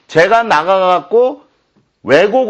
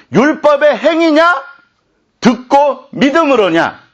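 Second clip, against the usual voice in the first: raised, ringing rebuke read from the Bible; scripture reading; 6 semitones higher than usual. Acted. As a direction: proclaim Paul's words as a stern challenge. Reads Galatians aloud forcefully.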